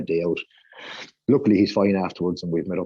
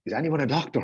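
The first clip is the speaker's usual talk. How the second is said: retold scream; 7 semitones higher than usual. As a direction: imitate a frantic cry for help at held-back volume. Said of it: "runs out of the internal room screaming".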